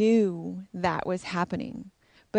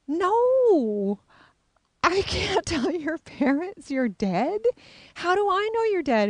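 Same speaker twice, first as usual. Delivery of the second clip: high, slow, flustered protest; incredulous refusal; 11 semitones higher, 3 dB louder, slower than usual. Retold or imitated. She refuses to tell the parents, high and incredulous. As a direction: push back in disbelief, half laughing. Refusing the ghost girl's request.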